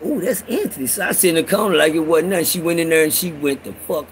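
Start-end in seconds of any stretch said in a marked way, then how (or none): 0.1-0.9 awed aside. Acted. Reacting to seeing crazy Anthony.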